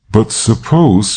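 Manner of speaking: unsure, slowed halting start; faltering. Acted. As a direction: let the words falter out. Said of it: "she faltered".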